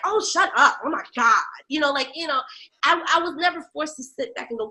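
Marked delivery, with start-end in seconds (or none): 0.1-1.4 louder, fed-up brush-off; exasperated. Retold or imitated. Telling the boyfriend to shut up, exasperated. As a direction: brush him off, annoyed. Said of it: "and I'm like".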